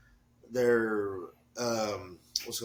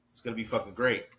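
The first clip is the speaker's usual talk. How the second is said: ordinary talk, nothing marked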